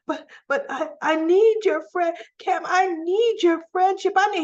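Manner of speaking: high, loud mock plea; hammed-up frantic pleading; retold desperate begging. Recapper voicing Joss begging for Cam's friendship, desperate.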